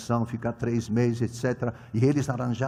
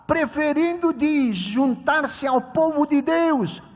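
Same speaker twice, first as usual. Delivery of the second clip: raised, emphatic preaching voice; 11 semitones higher, 4 dB louder than usual. Acted. Preacher reading Hebrews to the congregation, emphatic sermon delivery. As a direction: proclaim the scripture to the church.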